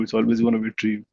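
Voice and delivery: ordinary talk, nothing marked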